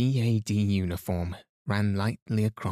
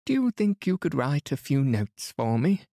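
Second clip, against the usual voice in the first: a higher, fussing matron; voiced older lady; 6 semitones higher than usual. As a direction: play her as a chattering hostess. Mrs Inglethorpe asks a favour.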